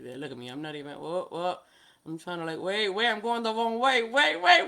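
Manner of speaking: ordinary talk, nothing marked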